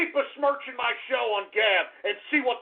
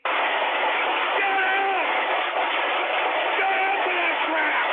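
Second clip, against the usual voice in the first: angry yelling; 8 semitones higher, much slower than usual. Acted. Yelling at chat.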